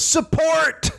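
high-pitched imitation holler; mock shouted greeting. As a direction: call it out like a rude announcement. Acts out the man's shouted greeting.

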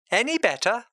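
ordinary delivery

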